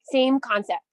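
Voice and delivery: ordinary delivery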